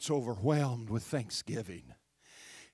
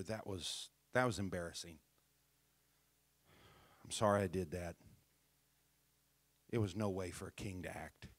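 soft, low, humble apology to his wife; a husband meekly saying sorry; 6 semitones lower, 6 dB quieter than usual. Retold or imitated. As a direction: grovel gently, quieter and lower. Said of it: "David could have said".

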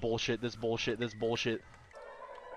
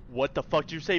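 ordinary talk, nothing marked

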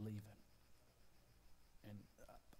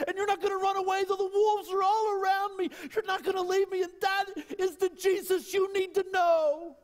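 high, loud climactic preaching cry; full-throated revival preaching; preaching at full force; 15 dB louder than usual. Acted. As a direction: lift it to a soaring shout from the pulpit.

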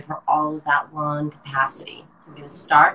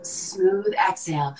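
slow, smooth exhale cue; slow calm cue; slower than usual. Shown as a demonstration. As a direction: draw out smooth slowly. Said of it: "breathing instructor cue".

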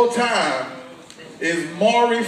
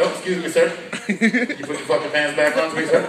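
lower, softer, overly polite host voice; Chris Hansen impression; 7 semitones lower, 4 dB quieter, faster than usual. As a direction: calmly shame him with fake politeness. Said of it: "Chris Hansen always come back like".

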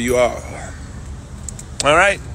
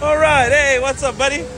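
excited; 11 semitones higher, slower than usual. Real, not acted. Excited arrival at Hogsmeade.

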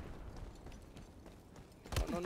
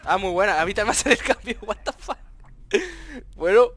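louder, higher laughing outburst; loud excited rant; 4 semitones higher, 8 dB louder than usual. Real, not acted. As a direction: blurt it out laughing. Team wipes, loud laughing rant.

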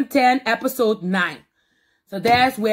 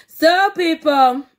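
drawn-out hype; 6 semitones higher, 5 dB louder, slower than usual. Real, not acted. Vlogger sign-off energy.

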